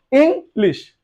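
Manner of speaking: English in two parts, "ing, lish"; split into syllables. Shown as a demonstration. Teacher showing there are two parts, English split as "ing, lish". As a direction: pause inside English after ing. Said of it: "আমাদেরকে একটু ভাঙতে হয়".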